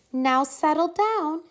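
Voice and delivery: high, sweet and gentle coaxing; sweet teacher voice. A narrator's reading. Teacher sweetly calming her unruly class. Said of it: "said Miss Nelson in a sweet voice".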